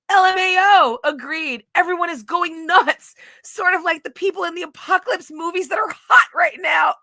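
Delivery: lightly voiced mock of the friend. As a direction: read his text up high like he's a fool. Mocking the friend's text.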